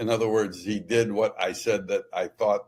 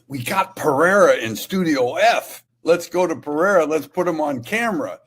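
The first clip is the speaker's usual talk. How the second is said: producers scrambling; 5 semitones higher, 4 dB louder than usual. Retold or imitated. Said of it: "they said".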